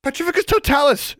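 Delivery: high, loud spell cry; shouted spell. A narrator's reading. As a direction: blast the spell at him. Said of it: "she shouted".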